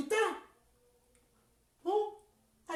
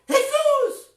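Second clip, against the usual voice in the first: higher, louder leading call; call for children to repeat; 4 semitones higher, 4 dB louder than usual. Shown as a demonstration. Clown leads children to repeat Jesús, calling out. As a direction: say it out so kids echo. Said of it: "Vayan a decir conmigo".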